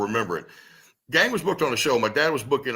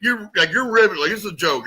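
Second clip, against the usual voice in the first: incredulous; 7 semitones higher, 4 dB louder than usual. Retold or imitated. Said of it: "Gang's looking at us like".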